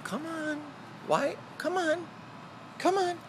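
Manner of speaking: high, soft coaxing call; coaxing a wild bird. Real, not acted.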